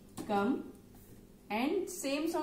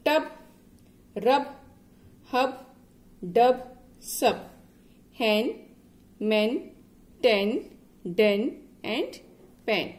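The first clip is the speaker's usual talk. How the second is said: each word alone, louder and emphatic; read-along drill; 7 dB louder, slower than usual. Shown as a demonstration. Teacher reading a word list with a child, loud and emphatic. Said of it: "just read it with me".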